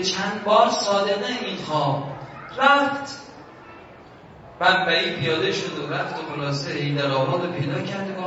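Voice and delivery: usual speaking voice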